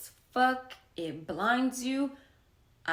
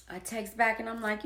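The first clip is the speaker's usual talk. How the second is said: normal talking voice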